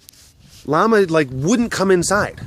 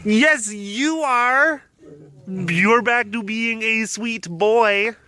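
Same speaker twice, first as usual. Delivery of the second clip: cooing pet talk; 7 semitones higher, slower than usual. Real, not acted. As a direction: coo affectionately, stretching the words.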